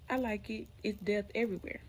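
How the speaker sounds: usual speaking voice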